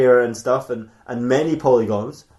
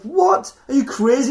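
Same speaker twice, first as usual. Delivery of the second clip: shocked disbelief; 13 semitones higher, 5 dB louder than usual. Acted. Friend shocked by occult plan.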